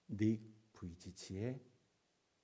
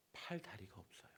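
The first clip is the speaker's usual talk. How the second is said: hushed; 6 dB quieter than usual. Real, not acted. Sad fact dropped low.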